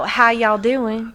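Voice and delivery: slow, put-on Southern drawl; playful Southern accent on request. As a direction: try a drawn-out Southern greeting. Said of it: "prove it to me right now".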